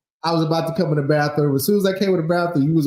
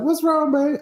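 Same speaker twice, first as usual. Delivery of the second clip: high-pitched, oblivious sweet question; innocent, lifted comic concern; mock innocence; 12 semitones higher than usual. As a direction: ask it in a put-on high voice. Acts innocent question to tired wife.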